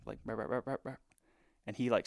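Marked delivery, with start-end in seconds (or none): 0.2-1.0 quick string of mouth-made calls; imitated deer-stopping call. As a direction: imitate calling a deer to a stop. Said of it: "just, like, stop".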